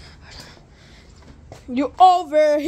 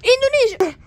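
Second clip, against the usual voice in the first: yell; 13 semitones higher, 11 dB louder than usual. Real, not acted. Random yell while caught.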